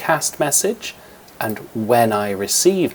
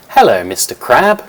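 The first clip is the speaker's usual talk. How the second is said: a lightly voiced cartoon cat, much louder, greeting brightly; 2 semitones higher, 12 dB louder than usual. Teacher recording the cat's line for Scratch, loud cat voice. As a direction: call out hello as a friendly cat. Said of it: "which is what the cat says".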